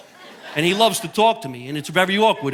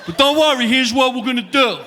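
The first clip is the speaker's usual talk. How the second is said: comedian as captain; 8 semitones higher than usual. Pretend. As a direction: announce it like a tipsy captain on the PA. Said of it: "if I came over the loudspeaker".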